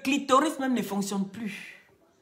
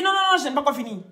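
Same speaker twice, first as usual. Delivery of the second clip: rapid-fire, louder "Non, non, non"; acted hurried protest; 5 semitones higher, 6 dB louder, much faster than usual. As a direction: interrupt fast as the insistent patient. Patient protests again in joke. (protestation précipitée, jouée)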